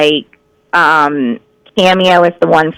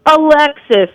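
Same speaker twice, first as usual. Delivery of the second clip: put-on lightly raised old man call; imitating father calling; 7 semitones higher, slower than usual. Father yelling at the speaker to mispronounce Alexa, mild mock call. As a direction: call the name out like a confused dad. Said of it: "He'll be like".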